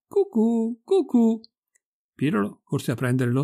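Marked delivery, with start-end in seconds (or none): 0.1-1.4 high, hooting cuckoo imitation; cuckoo call. Maid in feathers on the roof faking a cuckoo call. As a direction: sing out like a cuckoo. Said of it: "si sentiva proprio cantare".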